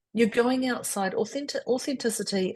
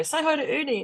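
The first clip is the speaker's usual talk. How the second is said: higher-pitched playful imitation of a teasing dad; imitating dad; 8 semitones higher than usual. Guest retelling her dad's belly button joke, imitating dad. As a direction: pitch it up like Dad joking with the cousins. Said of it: "He'd go".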